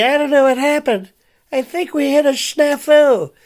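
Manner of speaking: put-on high fluttery old lady voice, puzzled. Radio host mocking an old lady's jargon, high old lady voice. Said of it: "when you hear some old lady".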